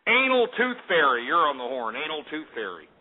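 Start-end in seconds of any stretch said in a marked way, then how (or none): none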